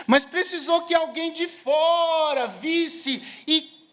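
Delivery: high, slow, drawn-out preacher's delivery; emphatic preaching. Acted. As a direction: stretch the key words out to the congregation. Preaching with raised voice. (pregação enfática, palavras alongadas)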